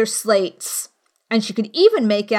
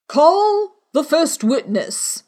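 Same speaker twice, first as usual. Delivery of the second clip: slow king voice; 5 semitones higher, much slower than usual. A narrator's reading. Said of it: "said the king".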